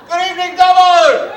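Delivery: drawn-out roaring hello to the crowd; big shouted greeting. Real, not acted. Comedian greeting the Dubbo crowd with a long roaring shout. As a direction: bellow it to the back row, stretching Dubbo.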